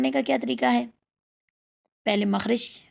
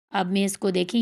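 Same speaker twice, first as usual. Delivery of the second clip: ordinary delivery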